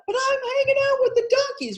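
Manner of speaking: loud, very high whiny protest; mock protest. Jana resisting the nudge to present, high mock protest. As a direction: imitate a reluctant student squealing an excuse. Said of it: "it's like she's going".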